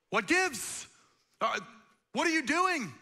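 what gives, asked high and loud; baffled high question. Retold or imitated. Preacher mimics a confused bystander, high and loud. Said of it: "You'd be like".

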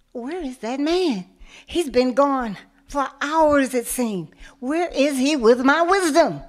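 loud, rising exasperation; impatient outburst. Acted. Tied-up tiger yells for the man, loud and impatient. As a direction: bark it out, fed up with waiting.

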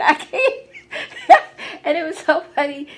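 high-pitched, loud burst of real laughter; laughing. Real, not acted. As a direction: crack up while telling it. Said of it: "I couldn't help but laugh".

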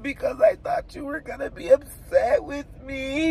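high-pitched imitation of explaining while crying; retold tearful explanation. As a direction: plead it as if in tears. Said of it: "I just started crying".

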